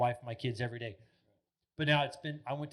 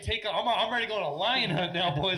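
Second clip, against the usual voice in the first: mock bravado; 7 semitones higher, 5 dB louder than usual. Mock bravado holding a stick.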